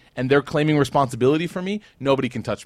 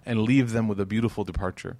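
ordinary talk, nothing marked

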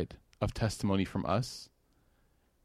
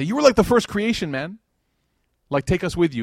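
high, loud plea, hammed up; mock pleading; 7 semitones higher, 5 dB louder than usual. People begging Adam to take them along, mock pleading. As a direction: plead like a desperate kid to an elder.

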